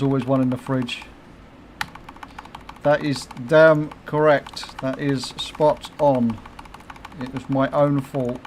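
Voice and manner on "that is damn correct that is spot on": slow, deliberate agreement; slow, firm agreement; emphatic agreement. Real, not acted. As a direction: spell it out slowly.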